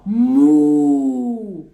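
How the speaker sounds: loud drawn-out "mu"; strong moo. Shown as a demonstration. Therapist modelling a forceful moo for the child. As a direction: push out a big cow "mu". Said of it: "eu vou fazer o som também".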